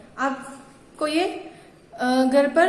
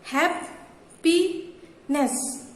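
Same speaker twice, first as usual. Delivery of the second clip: "Happiness" slowly broken into हैप / पी / नेस; syllable by syllable; 2 semitones higher, much slower than usual. Shown as a demonstration. Teacher showing three syllables, "happiness" said in three separate beats. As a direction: pause between the three pieces of "happiness". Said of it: "तीन टुकड़े हो रहे हैं ना".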